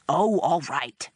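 slow, grudging agreement; suddenly impatient. A narrator's reading. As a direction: give in, fed up with the charade. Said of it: "seeming suddenly impatient with the whole charade".

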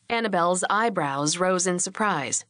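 ordinary delivery, nothing marked

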